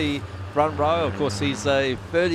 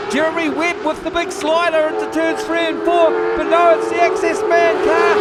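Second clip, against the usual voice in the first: loud, high, fast race call; excited race call; 9 semitones higher, 6 dB louder than usual. Real, not acted. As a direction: shout the finish over the engines. Live race call climax.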